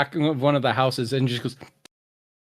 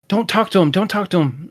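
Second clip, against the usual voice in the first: higher, urgent voice behind the door; lightly acted, higher-pitched warning; another villager's voice; 6 semitones higher than usual. Villager warning the child off the door, lightly voiced urgent higher voice. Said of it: "you can hear another voice like".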